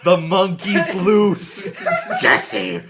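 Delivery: loud, slow panicked yell; Jesse Pinkman voice. Acted.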